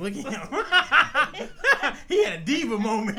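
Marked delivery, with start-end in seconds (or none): 0.0-1.9 laughing through the words; cracking up mid sentence. Real, not acted. Laughing at brother's diva moment.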